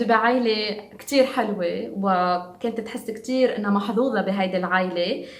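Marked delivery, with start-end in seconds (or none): none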